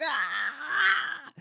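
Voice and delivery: long high-pitched mock scream; comic imitation of screaming in agony; mock pain scream. Retelling a wounded man's fall, mock pain scream. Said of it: "falls down screaming in pain".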